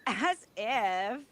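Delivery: mock drawn-out delivery. Woman teasing coworker about his catchphrase, mock drawn-out. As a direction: mimic an overdone catchphrase, softly. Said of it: "You don't have to say it all like".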